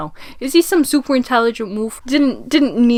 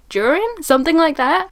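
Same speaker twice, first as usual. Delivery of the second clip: second guess, higher; 6 semitones higher than usual. Real, not acted. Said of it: "What the hell is his name?".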